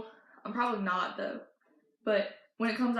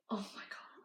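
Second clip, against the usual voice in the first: hushed, unvoiced whisper; whispered reaction; 3 semitones lower, 17 dB quieter than usual. Friend reacting to hearing Endgame, whispered. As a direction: breathe it out as a tiny whisper.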